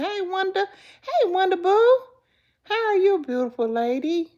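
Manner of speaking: high, loud gushing hello; excited greeting. Real, not acted. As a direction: gush over her arrival.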